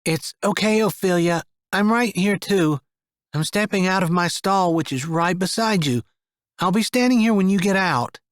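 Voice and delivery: put-on reassuring a scared girl beside her stall; soft sweet voice. A narrator's reading. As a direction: keep the child calm. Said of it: "softest and sweetest voice".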